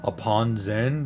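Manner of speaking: ordinary delivery, nothing marked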